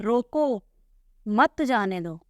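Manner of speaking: रोको drawn out, clear break, then मत जाने दो; pause after रोको. Shown as a demonstration. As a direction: stop right after रोको, then run मत जाने दो together. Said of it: "रुक गए हम यहाँ पर".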